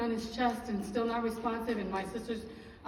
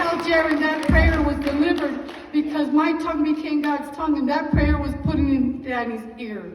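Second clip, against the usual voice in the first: loud, raised-pitch preaching delivery of a quote; triumphant proclamation; 5 semitones higher, 11 dB louder than usual. Retold or imitated. Passing on sister's message to the congregation, loud and triumphant. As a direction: proclaim the retold message at full force.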